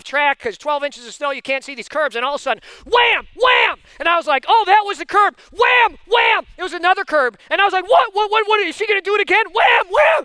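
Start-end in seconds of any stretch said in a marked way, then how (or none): 2.8-3.8 high, loud "wham" impacts; booming imitated bangs; shouted crash noise. Imitating car hitting the curb. 4.5-6.5 high, loud reenacted alarm; hammed-up shock; alarmed retelling. Retelling alarm at curb hits. 7.9-10.2 high, loud alarmed passenger; hammed-up rising alarm; mock panic. Panicking passenger in retold crash.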